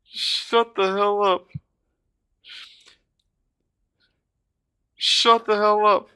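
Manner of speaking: high, slow, drawn-out amazement; stunned disbelief. Real, not acted. Collector staring at the rare pull, slow stunned disbelief. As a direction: draw it out high, unable to believe it.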